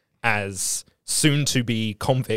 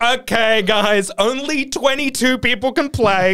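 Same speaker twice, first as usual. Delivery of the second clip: pompous official voice; 10 semitones higher, slower than usual. Pretend. Mocking the dork who made rules.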